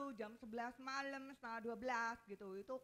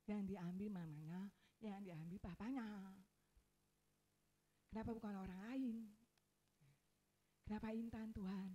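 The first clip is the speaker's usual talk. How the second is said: hushed, much softer plaint to God; retold grieving prayer; 2 semitones lower, 7 dB quieter than usual. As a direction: re-enact a small, defeated questioning of God.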